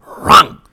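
sudden loud unvoiced noise for a knee locking up; imitated seizing noise. Knee tightening up after the shower, loud imitated seizing noise. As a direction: make the sound of a joint clamping tight.

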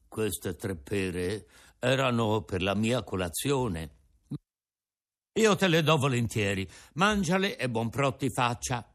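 put-on an old father offering his breakfast; old Geppetto.

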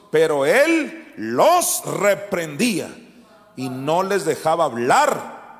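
loud, slow, forceful proclamation; fiery preaching. Acted. Preacher reading Jesus rebuking demons, loud and slow. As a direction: thunder out the rebuke.

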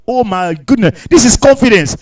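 ordinary talk, nothing marked

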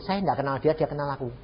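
ordinary delivery

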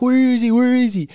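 put-on high, loud eager kid shout; excited calling. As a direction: call out like a kid hunting for someone. Kids searching for uncle, calling.

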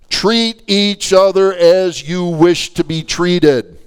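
high, loud, slow proclamation; emphatic preaching. Real, not acted. Preacher announces part two to church, emphatic preaching. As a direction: declare it word by word to the congregation. Proclaims the sermon's next point.

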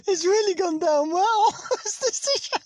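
can barely get the words out for laughing; high laughing. Real, not acted. Ironic remark while laughing hard.

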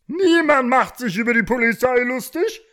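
high, loud indignant bark; loud officious rooster outburst. A narrator's reading. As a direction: snap it out as an offended cockerel cop. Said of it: "blaffte der Gockel".

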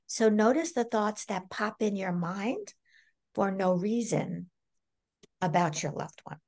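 ordinary talk, nothing marked

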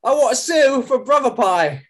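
high, loud hammy British voice; bad British accent. Host hams a posh British detective. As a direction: overdo a posh Brit. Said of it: "This has been my accent work".

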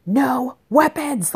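high, drawn-out mock scream without full volume; retold scream. Mom retelling her order to her knife-holding son, mock scream. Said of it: "like, I'm screaming it".

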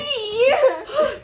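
loud high gasp with laughter; laughing gasp. Real, not acted. Gasp at spill.

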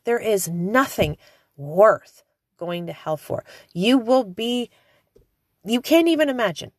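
ordinary talk, nothing marked

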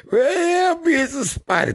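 very high, loud squeal for help; mock panicked cry. Friend stuck near a spider, imitated panicked squeal.

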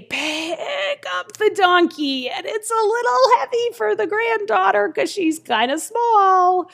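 high, strained voice of effort; straining to lift. Acted. Narrator mimics a small girl lifting a donkey, straining. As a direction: act out heaving a heavy load while talking.

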